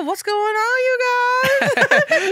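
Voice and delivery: high-pitched, loud mock innocent voice. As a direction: squeak it out like a clueless outsider. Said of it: "I go".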